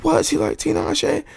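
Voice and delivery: high incredulous imitation of a surprised woman; mock shocked woman. Imitating a woman reacting to his drawing, high and incredulous. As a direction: play her disbelief high and wide-eyed. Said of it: "She's like".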